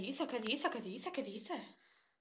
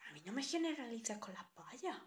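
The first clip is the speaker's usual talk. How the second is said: soft, muttered to herself; quiet echo of her own complaint; softer repeat; 6 dB quieter than usual. Acted. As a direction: repeat it under your breath. Repeats her line again, much softer.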